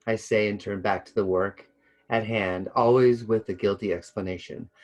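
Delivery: ordinary delivery, nothing marked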